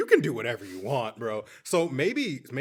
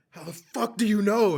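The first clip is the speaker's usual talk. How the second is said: shocked angry outburst; 8 semitones higher, 5 dB louder than usual. Retold or imitated. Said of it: "she's like".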